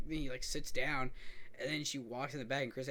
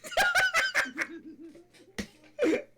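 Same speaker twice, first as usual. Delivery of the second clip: long high shrieking laugh; high laughing fit; 8 dB louder than usual. Real, not acted. Laughing at the quote.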